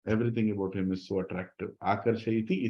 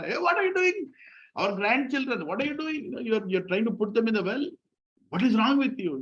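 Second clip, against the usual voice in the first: much higher, alarmed scolding; shrieking mother-in-law; 11 semitones higher than usual. Retold or imitated. Mother-in-law catching the gopi at the well, high alarmed scold. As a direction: shriek at her like a panicked in-law. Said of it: "and her mother-in-law".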